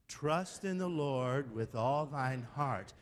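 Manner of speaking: slow, deliberate recitation of a verse; slow measured quoting. Shown as a demonstration. Preacher quotes verses to Jerry his own way, slow and measured. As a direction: recite it slowly, stretching the words. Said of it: "let's do it again".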